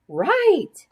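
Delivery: high, eager chorus answer; class calling back. A narrator's reading. Pupils answering their teacher, high eager. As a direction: shout it back like eager kids.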